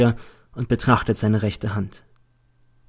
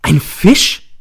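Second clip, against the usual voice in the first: laut und viel höher, entsetzt gerufen; reiß den Ruf erschrocken heraus; schreiend; 7 semitones higher, 8 dB louder than usual. A narrator's reading. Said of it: "Ich springe auf und schreie". (erschrockener Schrei)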